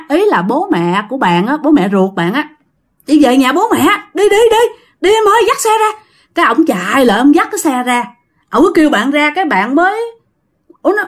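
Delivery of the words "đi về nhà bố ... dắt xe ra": frantic urging. Retold or imitated. Husband frantically urging departure.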